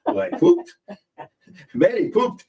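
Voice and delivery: laughing through the misreading, pitch jumping up. Shown as a demonstration. Reading the comment wrong and cracking up, laughing. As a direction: break into giggles on the word pooped. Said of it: "What I saw was".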